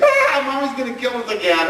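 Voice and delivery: put-on high, laughing kid whine; high mock child complaint; whining kids. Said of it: "I hear the two kids".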